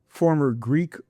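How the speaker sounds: normal talking voice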